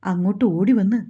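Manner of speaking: usual speaking voice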